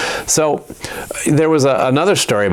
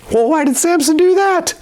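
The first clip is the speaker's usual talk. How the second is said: indignant Philistines. Acted. Philistines asking who burned fields.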